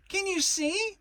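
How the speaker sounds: frantic little piglet yelling; shouting. A narrator's reading. Piglet shouting at Kanga to look at him, high shout. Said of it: "shouted Piglet".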